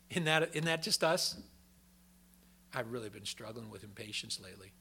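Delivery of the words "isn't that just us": normal talking voice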